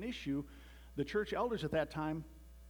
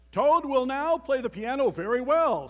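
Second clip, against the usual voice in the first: very loud, high stage announcement; booming showman, pitched high; strange announcer voice; 8 semitones higher, 12 dB louder than usual. A narrator's reading. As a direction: proclaim it like a theater showman. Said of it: "said the strange voice".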